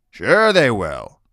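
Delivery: loud, drawn-out dismissal; sarcastic scoff. A narrator's reading. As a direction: scoff slowly and loudly. Grandfather scoffs at her hope.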